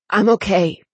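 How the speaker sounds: forced, strained reply; effortful burst from a struggling throat; words torn from throat. A narrator's reading. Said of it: "The words tore out from his throat".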